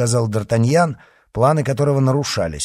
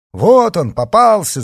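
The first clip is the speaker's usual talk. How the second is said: high, loud triumphant cry; excited shout; 12 semitones higher, 10 dB louder than usual. A narrator's reading. Riders charge at their cornered quarry, shouting. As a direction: shout it as you pounce. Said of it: "закричали всадники".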